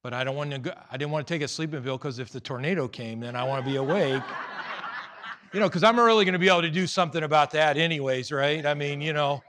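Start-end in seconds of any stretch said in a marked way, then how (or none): 4.3-9.4 loud, fast, amused sarcasm; real laughing, rattled-off aside; self-mocking laughter. Real, not acted. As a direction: laugh at your own silly logic. Laughing at own tornado logic.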